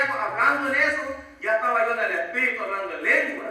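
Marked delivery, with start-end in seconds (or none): none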